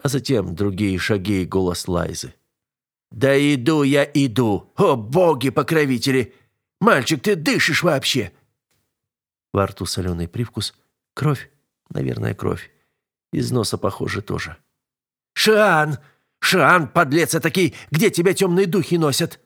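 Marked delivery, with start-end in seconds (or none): 3.1-8.3 higher, alarmed fussing; flustered, exclaiming older-servant manner; fussing servant woman. Acted. Voices flustered servant woman arriving. 15.3-19.4 high, loud, exasperated shout; irate servant hollering at higher pitch; scolding call. Acted. Servant calls and scolds helper.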